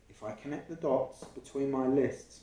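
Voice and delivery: ordinary delivery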